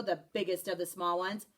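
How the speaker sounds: usual speaking voice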